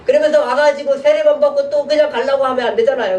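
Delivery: ordinary talk, nothing marked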